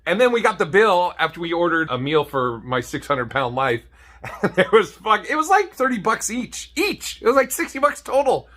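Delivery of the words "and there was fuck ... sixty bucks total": much higher, rising with disbelief; delighted, climbing pitch; surprised excitement. Real, not acted. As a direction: marvel that it was so cheap. Excited about cheap price.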